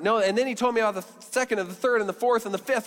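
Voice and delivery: high, fast, tumbling list; acted breathless rush of news; excited listing. Woman excitedly listing her husbands.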